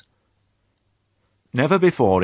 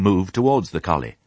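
ordinary delivery

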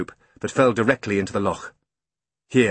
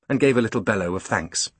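ordinary delivery

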